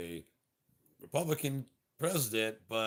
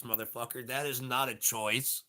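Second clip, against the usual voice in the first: normal talking voice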